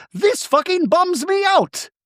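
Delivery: very high, slow mock complaint; hammed-up upset. Acts Bronwyn's upset complaint.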